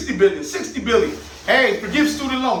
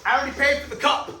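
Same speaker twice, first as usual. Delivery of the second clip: indignant colonist; 5 semitones higher than usual. Retold or imitated. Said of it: "they're like".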